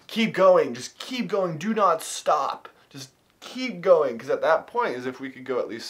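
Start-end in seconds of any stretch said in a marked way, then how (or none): 0.0-4.2 slow, higher and louder, pleading with the car; will the truck to keep going; urging on. Retold or imitated. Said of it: "And I'm like".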